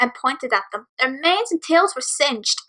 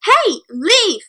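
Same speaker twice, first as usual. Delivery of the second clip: higher angry shout; angry yell; 8 semitones higher than usual. Acted. Sister shouting at bullies, angry raised voice. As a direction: snap at the bullies to back off. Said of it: "Honey yelled with so much anger".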